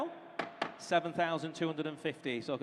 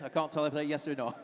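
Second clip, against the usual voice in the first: normal talking voice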